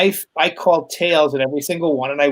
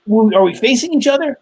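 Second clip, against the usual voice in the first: imitating his kid; 8 semitones higher, 4 dB louder, faster than usual. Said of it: "And he goes".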